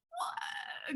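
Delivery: high breathless gasped what; shocked what. Retold or imitated. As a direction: gasp it in disbelief. Told she cannot attend.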